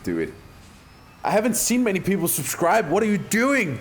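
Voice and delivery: ordinary talk, nothing marked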